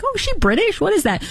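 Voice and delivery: high-pitched mock curiosity; puzzled onlooker. Host imitating someone fooled by an affected accent, puzzled.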